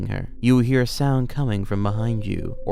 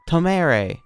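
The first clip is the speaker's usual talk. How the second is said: higher, louder instructed cry; raised-voice command; mild shout; 7 semitones higher, 5 dB louder than usual. A narrator's reading. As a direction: call it out as a warning, without a full yell. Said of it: "you should shout".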